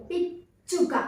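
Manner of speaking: పిచ్చుక split by a held stop on చ్చ; pressed double చ. Shown as a demonstration. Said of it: "ఒత్తి పలకాలి".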